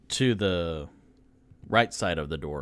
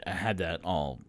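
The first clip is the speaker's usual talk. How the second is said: ordinary talk, nothing marked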